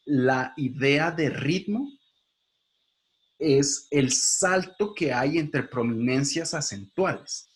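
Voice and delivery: slow, deliberate, every word weighted; slowed-down teacher emphasis; slow emphatic definition. Shown as a demonstration. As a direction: spell the idea out slowly for the class. Stresses key definition slowly.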